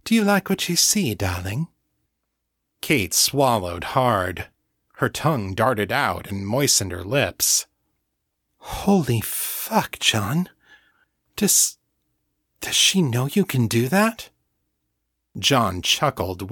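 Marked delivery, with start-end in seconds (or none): 0.0-1.7 higher, quick posh question; put-on posh voice, pitched up; posh upper-class accent. A narrator's reading. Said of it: "return to the posh, upper-class accent". 8.6-14.3 halting, astonished reaction; stunned disbelief. A narrator's reading.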